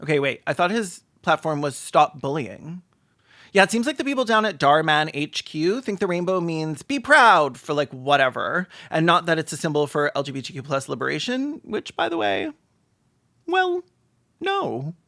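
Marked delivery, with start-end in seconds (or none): none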